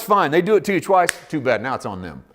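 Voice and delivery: ordinary delivery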